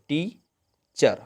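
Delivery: Teacher very slowly, टी, a long pause, then चर; two separate beats on teacher; gap between syllables. Shown as a demonstration. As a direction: pull teacher apart into टी and चर. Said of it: "बीच में gapping आ जाता है".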